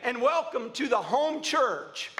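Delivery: stretched, high openings dropping at each line's end; declamatory preaching. Real, not acted. Preacher welcoming worshippers to the home church, declamatory preaching. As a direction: herald it to the congregation.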